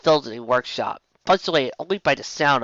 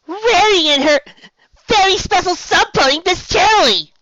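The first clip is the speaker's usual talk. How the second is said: upset; 9 dB louder than usual. A narrator's reading. Sweetie Belle upset.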